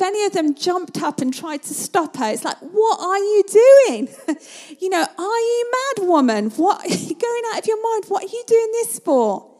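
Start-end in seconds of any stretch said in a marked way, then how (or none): 2.6-9.5 loud, high, disbelieving cry with a laugh breaking in; an imagined disciple shouting at the woman in disbelief; incredulous outburst. Pretend. Disciple yelling at Mary, loud and incredulous.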